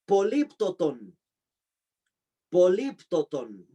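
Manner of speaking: políptoton said slowly, each syllable spelled out for the class; a teacher drilling the word políptoton for students to write; slow dictation. Shown as a demonstration. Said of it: "teacher drills a term".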